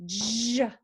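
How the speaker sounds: buzzing burst on "j"; voiced J. Shown as a demonstration. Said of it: "you turn your voice on".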